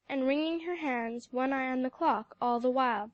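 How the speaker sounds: ordinary delivery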